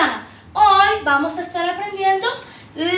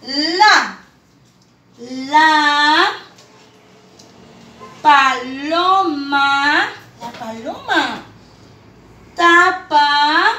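long pauses between words; spaced reading for children; much slower than usual. Shown as a demonstration. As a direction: read "la paloma tapa su nido" word by word. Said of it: "vamos a la cuarta oración".

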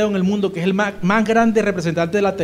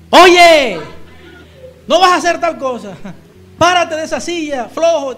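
put-on sharp high yell at the lazy kids; mother yelling; 9 semitones higher, 7 dB louder than usual. Mother shouting at her sons to obey, high and loud. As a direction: bark the order across the house. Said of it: "mi mamá tiene que decir".

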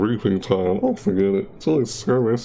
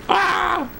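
much higher, shrieking and laughing mid-fight; frantic high screaming; much slower than usual. Real, not acted. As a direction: yell like the game is pure chaos.

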